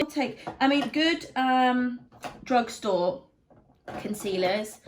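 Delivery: eager excited jump to drugstore picks. Real, not acted. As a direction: perk right up for the next pick. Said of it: "excited switch of topic".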